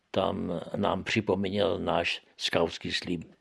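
ordinary delivery

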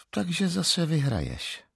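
a hint of a bored wife; without interest. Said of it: "řekla bez zájmu".

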